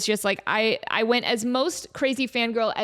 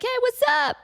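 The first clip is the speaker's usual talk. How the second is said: much higher, bouncy hello; mock chirpy greeting; 14 semitones higher, 4 dB louder than usual. Posing with friends for a picture, chirpy mock hello.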